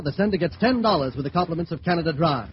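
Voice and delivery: normal talking voice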